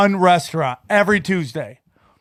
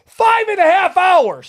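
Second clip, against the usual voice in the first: incredulous emphasis; 9 semitones higher than usual. Retold or imitated. Retold answer, high pitched.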